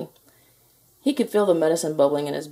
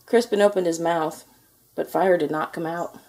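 ordinary delivery

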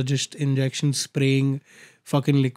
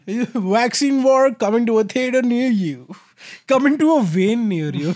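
trailer voice; 5 semitones higher than usual. Pretend. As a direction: sell it like a film promo. Mock movie trailer announcer.

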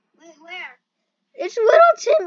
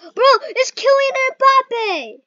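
excited shout; 7 semitones higher, 9 dB louder, slower than usual. Real, not acted. Excited shout on spotting the player.

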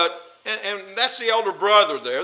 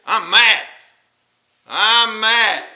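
loud mock-angry outburst; acted anger; 3 semitones higher, 6 dB louder, much slower than usual. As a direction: hammer it out as someone fed up. Voicing people who say they're mad.

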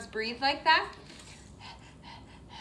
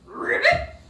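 much higher squeaky ribbit; frog croak; 10 dB louder than usual. Pretend. Teacher doing froggy pose for kids, high ribbit. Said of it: "how high you can hop".

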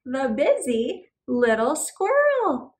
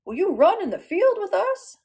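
louder, higher eager dog voice; 4 semitones higher, 5 dB louder than usual. Acted. Storyteller voicing Dog inviting Squirrel, eager. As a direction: bounce it like a playful pup. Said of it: "said Dog".

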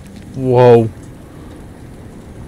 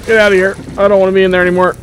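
panicky escape; 7 semitones higher, faster than usual. Real, not acted.

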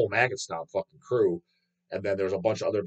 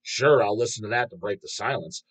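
ordinary talk, nothing marked

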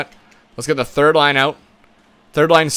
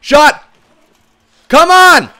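much higher and louder, willing it in; urgent cheer; 13 semitones higher, 7 dB louder, much slower than usual. Real, not acted. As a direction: yell the shot toward the net. Urging a shot in.

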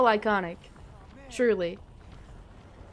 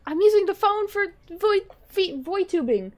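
exasperated shout; 7 semitones higher, 7 dB louder than usual. Real, not acted. Shouting the garbled word at the chat, loud. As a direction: bellow the word Voitubing.

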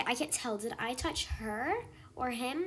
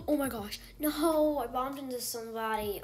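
ordinary talk

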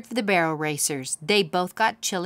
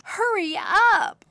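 much higher, drawn-out yell; 5 dB louder, slower than usual. Acted. Girl yelling at her brother to hurry. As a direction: yell it to get him moving. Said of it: "yelled Katie".

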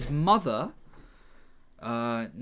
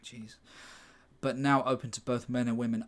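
ordinary talk

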